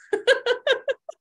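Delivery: bright high burst of laughter; real amused laugh. Real, not acted. Laughs after admitting loss of control.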